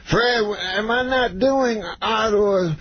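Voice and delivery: high, slow, stretched words; Bill Cosby. Retold or imitated. Star turns to his agent, slow high Bill Cosby impression. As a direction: imitate a star comic querying his agent. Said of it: "He goes".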